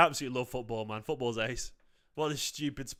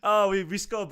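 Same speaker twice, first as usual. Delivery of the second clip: manic laughing; 6 semitones higher, 6 dB louder than usual. Real, not acted. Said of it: "laughing manically".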